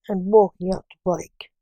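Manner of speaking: plain reading voice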